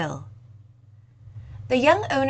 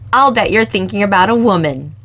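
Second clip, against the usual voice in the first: put-on louder, friendly young woman voice; a lively young cafe owner, voiced louder; 12 dB louder, faster than usual. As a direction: owner kindly offering the lingering customer more time, louder. Owner teases.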